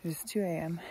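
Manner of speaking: ordinary delivery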